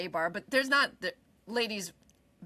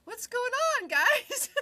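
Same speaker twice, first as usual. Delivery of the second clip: chirpy high mock hello; lightly sing-song hello; high casual greeting; 13 semitones higher, slower than usual. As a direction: play it innocent and bright. Her casual greeting, retold.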